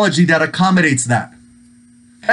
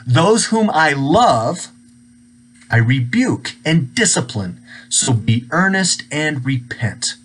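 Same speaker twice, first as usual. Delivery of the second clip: solemn scripture reading; 3 semitones lower, slower than usual. Acted. Pastor to his church, reading Jesus' rebuke in a slow, hushed voice. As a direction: read it like holy words, slowly.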